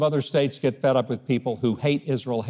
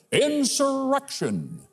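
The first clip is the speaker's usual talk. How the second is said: slow, drawn-out "insurrection" with mock gravity; mock-dramatic; 11 semitones higher, slower than usual. Host mocks talk of an act of insurrection, mock-dramatic. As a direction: stretch "insurrection" out for sarcastic effect. Sarcastic use of a loaded word.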